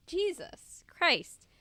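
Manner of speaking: slow, strained after a cough; exasperated after a coughing fit. Real, not acted. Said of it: "Sorry, I'm coughing".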